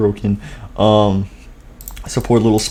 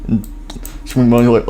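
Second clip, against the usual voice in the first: ordinary delivery, nothing marked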